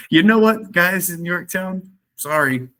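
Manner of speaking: high-pitched, drawn-out mock apology. British apologize to their men at Yorktown, mock-sheepish. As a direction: play a sheepish, hammed-up "sorry, guys".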